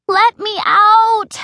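slow, stretched high loud scream. Acted. Heard mom downstairs, screams slowly to be let out. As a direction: drag out the plea at full voice. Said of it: "I scream".

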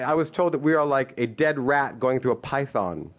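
usual speaking voice

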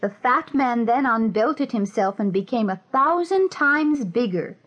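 plain reading voice

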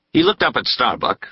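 ordinary delivery, nothing marked

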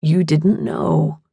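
slow, low reassurance; soothing. A narrator's reading. Phil reassuring the upset narrator, slow and soothing. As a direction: comfort gently, low and slow.

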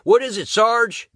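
high, loud worried question to the sergeant; a young trooper calling out, alarmed; startled soldier. Acted. Trooper asks the sergeant, high and alarmed.

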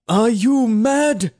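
high, loud, slow outraged shout. A narrator's reading. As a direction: yell it out in disbelief. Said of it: "the overseer shouted".

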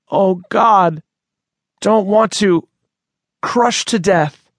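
squeezed by the snake, fighting for air; gasping for breath. Acted. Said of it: "His breath came in shallow bursts".

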